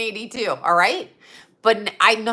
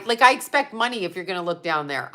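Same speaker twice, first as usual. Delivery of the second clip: ordinary delivery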